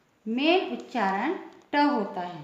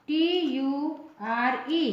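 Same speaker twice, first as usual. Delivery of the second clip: normal talking voice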